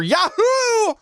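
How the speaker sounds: high yelled Yahoo. Shown as a demonstration. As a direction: shout Yahoo like its exclamation point. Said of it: "I yelled Yahoo because of the exclamation point".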